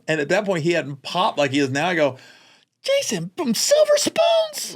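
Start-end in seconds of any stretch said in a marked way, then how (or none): 2.8-4.8 high, slow disbelief; high squeaky mock astonishment; incredulous. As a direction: scoff in total disbelief at the name. Mocking the replacement actor.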